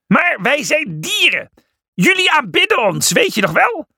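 shouting back, very high-pitched; Felix. A narrator's reading. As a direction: shout a protest back over the shoulder. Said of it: "Felix draaide zich om en schreeuwde terug". (hoog terugschreeuwen, verontwaardigd)

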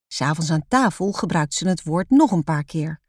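ordinary delivery

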